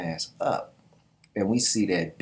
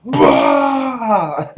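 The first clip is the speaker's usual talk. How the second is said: huge high battle scream, full force; Zeno's war cry, screamed at full volume; warrior's roar; 11 dB louder than usual. Acted. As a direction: scream it like a berserker charging. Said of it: "he's screaming his ass off".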